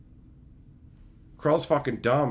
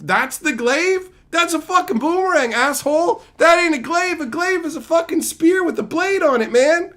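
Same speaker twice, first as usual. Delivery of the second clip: high, loud outraged rant; indignant shouting; 10 semitones higher, 5 dB louder than usual. Real, not acted. Streamer shouting at the movie about the glaive, outraged. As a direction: yell it like the film insulted you. Ranting about the wrong weapon.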